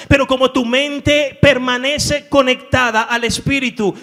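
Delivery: preaching at full force. Acted. Pastor preaching to his church, loud and fervent. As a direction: preach it out with conviction.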